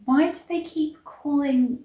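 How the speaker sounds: ordinary talk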